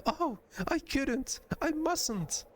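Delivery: very high, slow dismayed gasping refusal; high innocent voice, scandalized; shocked reluctance. Acted. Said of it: "looking shocked".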